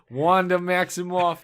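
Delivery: put-on slow Boston accent, drawn out. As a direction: say Wanda Maximoff like a Bostonian. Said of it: "Maximoff with a Boston accent".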